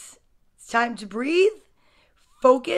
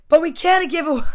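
put-on louder, slightly Italian-accented plea; accidental Italian accent; 3 semitones higher, 6 dB louder than usual. Reader voicing Spencer slips into an Italian accent. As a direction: slip into a hint of Italian woman accent. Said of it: "just turned into an Italian woman".

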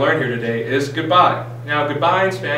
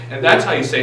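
ordinary delivery

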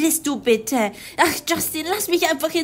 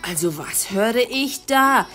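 stern father; 5 semitones lower than usual. Acted. Father scolding his wife for snobbery, low stern voice.

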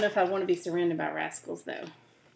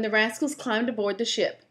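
plain reading voice

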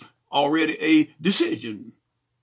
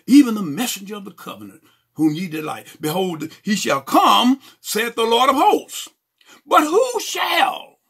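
preached scripture reading; 6 semitones higher, 6 dB louder than usual. Acted. Preacher reading of the Lord's coming, building proclamation. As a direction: read it out as a building pulpit cry.

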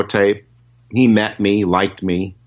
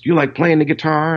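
high mock teasing question; 10 semitones higher than usual. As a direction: keep the prank going. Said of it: "he goes".

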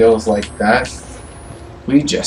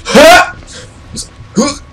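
high, explosive "ha" grunts; Link attack cries; 9 dB louder, slower than usual. Acted. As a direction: punch out video-game fighting shouts. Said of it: "It's like Link".

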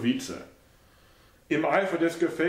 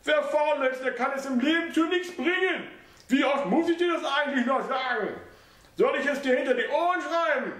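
put-on much higher, scolding old woman; high mother's voice, stern lecture; lecturing old mother; 14 semitones higher than usual. A narrator's reading. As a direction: lecture him on laziness.

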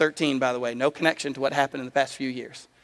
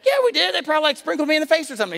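put-on high-pitched, hurried kid comeback; indignant protest; 13 semitones higher, 4 dB louder, faster than usual. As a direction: argue back like an insistent little brother, loud and high. Retold argument with brother.

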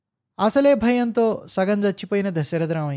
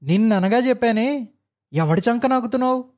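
raised, louder furious rebuke; angry shout; 6 semitones higher, 3 dB louder than usual. A narrator's reading. Engineer yells at the old clerk, furious. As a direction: yell at the clerk in rage. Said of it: "అరిచాడు".

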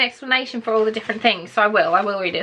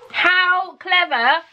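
1 admiring; 7 semitones higher, much slower than usual. Real, not acted. Admiring handmade gift.

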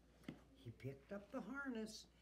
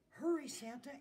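raised, urgent reindeer voice; lightly voiced hurrying reindeer; reindeer answering; 6 semitones higher, 9 dB louder than usual. A narrator's reading. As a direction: urge him on, high and loud. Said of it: "answered the reindeer".

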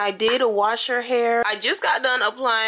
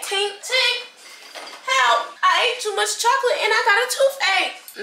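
put-on high whiny little-kid plea; pitched-up pretend toddler complaining about chocolate; whiny child voice; 10 semitones higher than usual. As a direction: play the kid with a toothache, pleading. Mom voices child's toothache plea.

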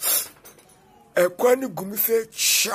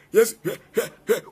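normal talking voice

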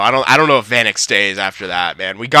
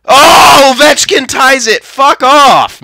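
angry shout; 13 semitones higher, 8 dB louder, slower than usual. Real, not acted. Opponent ties the game.

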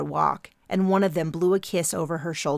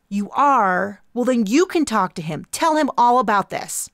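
intense activist voice; 5 semitones higher, 6 dB louder than usual. Acted. Intense young activist character.

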